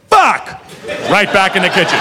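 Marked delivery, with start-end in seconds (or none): 0.1-0.6 much higher, sharp curse. Retold or imitated. Boss after the disaster, sharp high curse. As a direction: bark it and walk off. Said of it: "Guy just goes".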